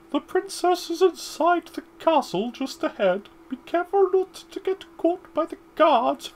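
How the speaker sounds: high hooting wise owl voice, hoo-ing and chuckling. Acted. Owl guide advising the boy in a high hooting voice. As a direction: play the old owl with a squeaky high voice. Reads the owl's lines aloud.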